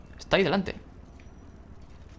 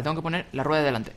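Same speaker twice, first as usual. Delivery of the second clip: ordinary talk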